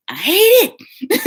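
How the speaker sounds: high, loud, drawn-out I hate it; exasperated outburst. Real, not acted. New tech venting about the job, high exasperated cry. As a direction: throw it up high in frustration.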